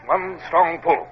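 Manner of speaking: usual speaking voice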